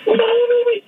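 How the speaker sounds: very high, spaced-out voice; dazed tripper. Retold or imitated. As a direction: answer from far away, lost. Voicing the tripping patient.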